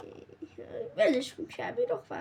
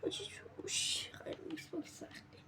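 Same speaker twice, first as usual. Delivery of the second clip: soft whispered aside; 15 dB quieter than usual. Real, not acted. Gamer whispering a complaint to himself. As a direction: whisper it to yourself. Muttering to himself while playing.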